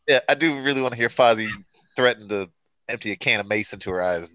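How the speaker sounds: ordinary talk, nothing marked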